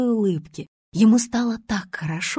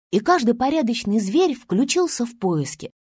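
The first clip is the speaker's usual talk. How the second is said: usual speaking voice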